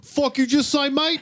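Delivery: high, mock English hooligan snarl; a hooligan spitting back in a put-on English accent; mock British hooligan. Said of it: "they're just like".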